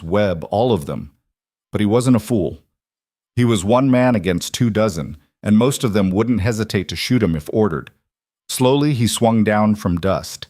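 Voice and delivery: ordinary delivery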